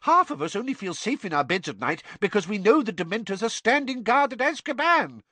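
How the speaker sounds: high, fast, indignant; outraged Fudge. A narrator's reading. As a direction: rant in disbelief. Fudge ranting.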